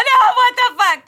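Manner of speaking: high, loud, slow panicked yell; retold scream. Mother screaming at her crying daughter, high slow panicked yell. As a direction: scream it in alarm. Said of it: "I start screaming hella loud".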